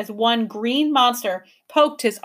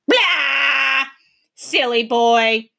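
taunting creature; 3 semitones higher, 5 dB louder, slower than usual. A narrator's reading. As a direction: jeer at the boy. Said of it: "sticking out its tongue".

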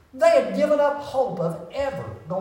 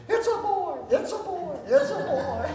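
high, joyful shouted announcement with laughter; excited shout; 11 semitones higher than usual. Retold or imitated. New father shouting to the delivery room, jubilant. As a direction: crow it out like a brand-new dad. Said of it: "jumped up and down and shouted".

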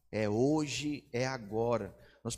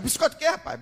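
much higher, loud disbelief; incredulous outburst; 13 semitones higher, 8 dB louder, much faster than usual. Acted. Atheist neighbor reacting to the word biscuit, high and incredulous. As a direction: blurt it out in shocked disbelief.

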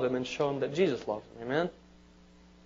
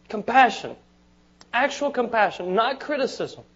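impassioned preaching; 6 semitones higher than usual. Acted. Preacher rises in pitch and force.

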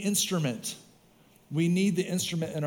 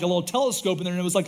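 ordinary talk

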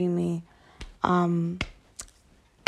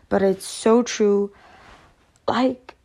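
ordinary talk, nothing marked

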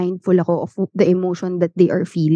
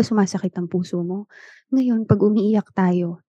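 usual speaking voice

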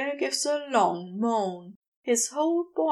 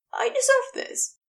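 rushed. Acted. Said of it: "He says it so quickly".

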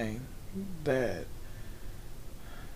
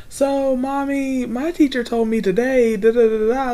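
put-on chatty child; 8 semitones higher, 5 dB louder than usual. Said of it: "they'll come home and be like".

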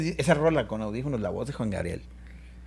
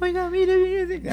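much higher, thin and timid; thin nervous voice; much slower than usual. Retold or imitated. Speaker imitating himself on the phone to his idol, thin nervous high voice. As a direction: squeak it out like a scared fan. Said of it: "hablando delgadito, güey, todo nervioso".

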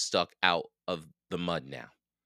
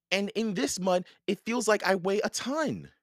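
put-on high-pitched girl's complaint; complaining young girl; 13 semitones higher than usual. A narrator's reading. Annie stuck in mud to Jack, complaining.

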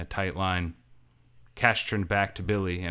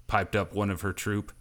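ordinary delivery, nothing marked

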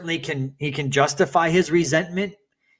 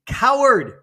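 high, loud one-word shout; angry acted scream; 10 semitones higher, 7 dB louder than usual. Angered son screaming at the echo, high loud shout. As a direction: hurl it out at the mountain. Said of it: "Angered at the response, he screams".

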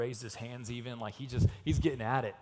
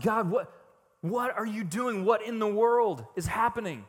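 loud, raised-pitch exasperation; bewildered complaint; 7 semitones higher, 7 dB louder than usual. Acted. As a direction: demand an explanation from God. Acts David's bewildered complaint.

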